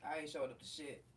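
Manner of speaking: low, settled refusal; I ain't showing up to shit, said low and final; firm refusal. Real, not acted. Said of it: "repeats same words".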